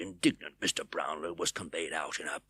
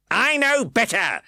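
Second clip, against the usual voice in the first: dismissive; 8 dB louder, slower than usual. A narrator's reading. Fang dismissive.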